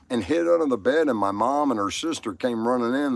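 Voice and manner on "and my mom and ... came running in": normal talking voice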